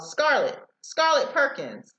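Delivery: called out high across the hill; a searcher calling a lost girl by name, high and carrying. Acted. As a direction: call her name from down the hill.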